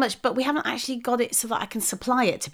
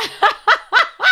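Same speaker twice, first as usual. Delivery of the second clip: high laugh; 11 semitones higher, 6 dB louder than usual. Real, not acted. Laughing at four in drawer.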